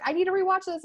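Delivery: high, loud and eager; excited. Retold or imitated. Telling her friend she must rewatch it, eager and high. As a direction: blurt it out like you can't wait.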